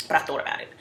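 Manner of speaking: ordinary delivery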